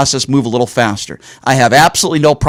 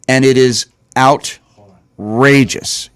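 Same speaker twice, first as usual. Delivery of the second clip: very slow, "outrageous" broken into drawn-out pieces; emphatic indignation; 2 semitones lower, much slower than usual. Real, not acted. Host condemns Republican double standard, slow and indignant. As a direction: drag out "outrageous" with heavy pauses.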